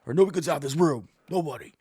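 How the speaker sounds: imitating angry O J. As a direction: bark orders at the room like O J on the tape. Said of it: "I'm like".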